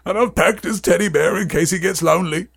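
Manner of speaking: choked-up character voice, lilting; muffled, sentimental fretting; muffled Hagrid. Acted. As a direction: play a doting keeper trying not to cry. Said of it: "said Hagrid in a muffled voice".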